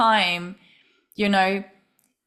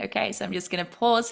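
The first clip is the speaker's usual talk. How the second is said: ordinary talk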